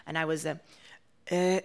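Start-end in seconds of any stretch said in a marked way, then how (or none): 1.3-1.6 put-on Jordanian accent. Jordanian woman asking her roommates for skim milk, Jordanian accent. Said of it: "it was the Jordanian character".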